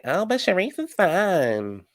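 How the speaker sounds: high, slow, sing-song mock of a dismissive friend; drawn-out high mocking impression; mocking Mia. Recapper imitates Mia brushing off Cherie, mock airy voice.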